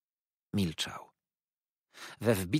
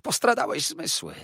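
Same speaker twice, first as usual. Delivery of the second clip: much higher, louder, shrill with alarm; shocked fawning clerk; 6 dB louder than usual. A narrator's reading. Uriah, slapped by Copperfield, cries out much higher. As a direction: squeal it like a wounded toady. Uriah recoils after being struck.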